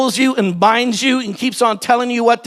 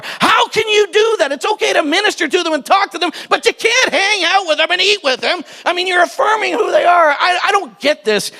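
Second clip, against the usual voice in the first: indignant; 7 semitones higher than usual. Acted. Religious people objecting to the father, indignant outcry. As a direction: rant like a scandalised Pharisee.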